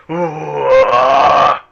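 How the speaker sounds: loud, booming giant's roar from the cave. Acted. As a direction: bellow it out like a huge giant.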